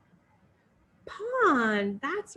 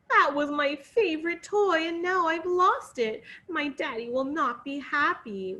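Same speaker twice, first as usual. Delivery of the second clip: weepy princess; 6 semitones higher than usual. Acted. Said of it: "wept the princess".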